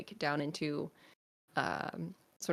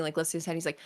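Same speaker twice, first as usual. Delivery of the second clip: ordinary talk, nothing marked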